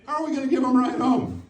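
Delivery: high, frantic, spiralling panic; frantic kid. Retold or imitated. Son panicking at his dad about the ride home, high and frantic. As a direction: spin out into full-blown panic. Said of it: "He's like".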